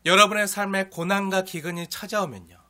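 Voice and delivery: normal talking voice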